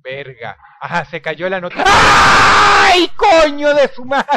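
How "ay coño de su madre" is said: high loud shriek breaking into laughter; scream, then laugh it off; scream then shaky laugh. Real, not acted. Gamer screams at a scare then laughs.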